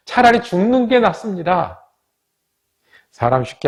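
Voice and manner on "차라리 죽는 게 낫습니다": pitched high, bitter outburst; angry despair. Retold or imitated. Jonah furious at God, high bitter outcry. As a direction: spit out the wish to die. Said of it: "몹시 화가 나서".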